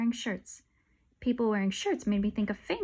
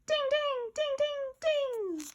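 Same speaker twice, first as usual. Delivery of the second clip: high bell-like dings; chiming dings; slower than usual. Acted. Kids imitating the sale notification, high chimes. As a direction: ring out like a chime.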